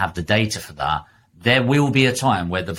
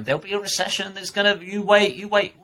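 much higher, sing-song mock warning; mocking the recession prophets, much higher; mocking doom-sayer; 10 semitones higher than usual. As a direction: parody the know-it-all predicting a crash. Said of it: "those people that are going".

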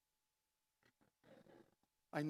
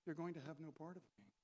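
softer, lower and kindly; gentle correction; 4 semitones lower, 11 dB quieter than usual. Shown as a demonstration. Preacher giving Jesus' words gently after the mock threat. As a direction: say it quietly and kindly. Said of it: "He said".

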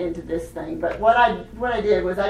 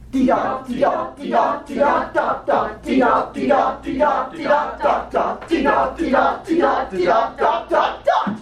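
British accent; 3 semitones higher than usual. Shown as a demonstration. Teacher and class try the rhythm together, British accent. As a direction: chant it in time with British vowels. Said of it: "Try it in your best British accent".

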